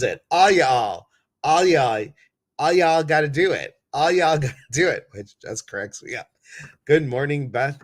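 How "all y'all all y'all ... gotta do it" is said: loud, high catchphrase call; playful catchphrase. Acted. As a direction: belt it out for fun.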